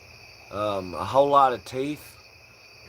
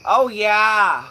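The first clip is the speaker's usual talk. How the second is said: much higher, loud hammed-up Midwestern voice; mock a chirpy Midwesterner; Midwestern folk accent; 9 semitones higher, 8 dB louder than usual. Stoner mocking Midwestern folk, hammed-up Midwestern accent. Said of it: "the Midwestern folk".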